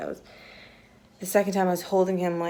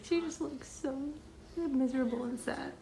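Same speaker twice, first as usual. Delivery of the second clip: higher and softer, sympathetic; soft pitying; 5 semitones higher, 7 dB quieter than usual. Real, not acted. Mom over her miserable baby, soft pity.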